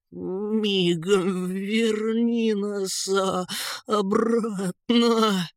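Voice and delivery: high, drawn-out shivering demand; stuttering with cold. A narrator's reading. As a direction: force the order out through the shivers. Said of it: "заикаясь от холода". (дрожа и заикаясь от холода)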